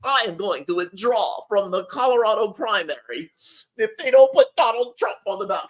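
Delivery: mock a self-serving video declaration; Vivek. Imitating a candidate announcing his withdrawal, mockingly declamatory. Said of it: "Vivek comes out with this self-serving little video".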